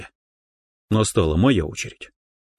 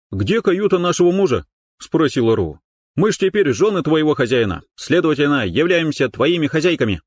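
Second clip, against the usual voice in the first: lightly voiced demanding woman; 8 semitones higher than usual. Rou demands the cabin.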